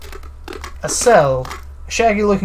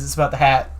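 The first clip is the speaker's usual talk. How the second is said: normal talking voice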